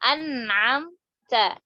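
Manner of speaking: An'amta stretched very slowly, nun then ain; slow demo word. Shown as a demonstration. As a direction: recite An'amta slowly for the class. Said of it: "Nun ketemu ain". (An'amta dibaca sangat pelan)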